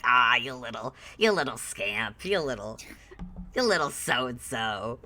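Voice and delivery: low, affectionate teasing; playful fondness. Acted. Charmed man rustles Ruby's hair, fondly teasing. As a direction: tease like ruffling a kid's hair. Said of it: "rustles your hair a little bit".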